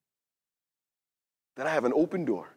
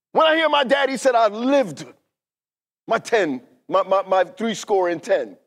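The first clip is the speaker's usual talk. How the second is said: raised, urgent sermon delivery; impassioned preaching; 4 semitones higher, 5 dB louder, faster than usual. Real, not acted. Preacher confessing he is not ready, impassioned preaching. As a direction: drive it at the congregation, building in pitch and volume.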